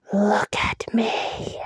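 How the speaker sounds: slow hollow whisper with a moan; slow creature whisper. Acted. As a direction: drag out the ghostly call. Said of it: "hollow voice whispered".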